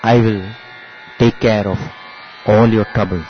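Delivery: solemn divine proclamation. Retold or imitated. Swami proclaims himself Sai Baba to the family, low and solemn. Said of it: "And that is when Swami says".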